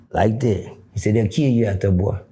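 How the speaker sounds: put-on low, serious old man's warning; grave old man. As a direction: drop it deep and grave.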